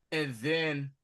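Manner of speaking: ordinary talk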